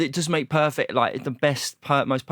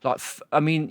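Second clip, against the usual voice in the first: normal talking voice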